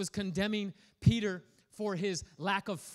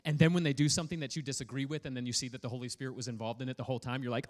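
usual speaking voice

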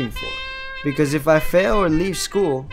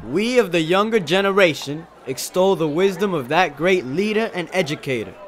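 higher, projected speechmaking voice; shouted oration; 5 semitones higher, 4 dB louder than usual. Acted. Young man addressing the gentlemen, shouted speech. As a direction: declaim it to a noisy room. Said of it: "I shouted".

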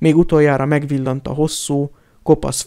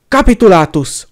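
loud, higher shouted spell; roared spell; 5 semitones higher, 8 dB louder than usual. A narrator's reading. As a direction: fire the spell out at full voice. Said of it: "ordította Harry".

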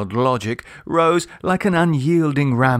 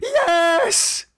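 high pitched desperate yes; mild scream. A narrator's reading. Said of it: "screamed Octavian".

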